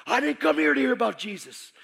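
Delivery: loud, high indignant shout; shouting at the pastor about Jesus; angry shout. Retold or imitated. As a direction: throw it back at him angrily. Arguing with pastor.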